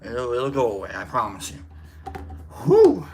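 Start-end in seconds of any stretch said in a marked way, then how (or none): 2.6-3.1 whew. Real, not acted. Exhaling after heavy topic.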